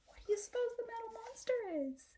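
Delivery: high, fast, playful quiz voice; teasing question. Real, not acted. Teacher asking children a riddle, playful and high. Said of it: "teacher quizzes the class".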